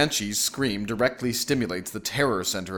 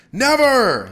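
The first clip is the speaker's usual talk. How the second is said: high, loud refusal; husband's defiant cry; defiant shout; 9 dB louder than usual. A narrator's reading. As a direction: yell it stubbornly over the noise. Husband defiantly refuses amid din.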